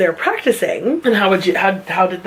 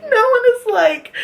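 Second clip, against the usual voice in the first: laughing; 14 semitones higher than usual. Real, not acted. Laughing while retelling the story.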